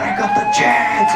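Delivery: sudden very high squeal of joy; excited shout. Retold or imitated. Comedian reliving the call to host, squealing with excitement. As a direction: burst out like you just won. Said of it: "I was so excited".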